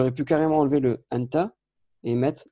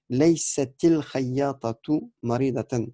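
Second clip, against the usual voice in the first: slow reading; slower than usual. Shown as a demonstration. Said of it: "reading the example sentence".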